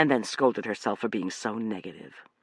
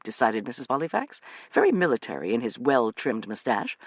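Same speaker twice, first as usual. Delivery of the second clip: ordinary delivery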